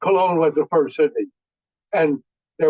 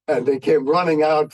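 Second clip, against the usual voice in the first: ordinary delivery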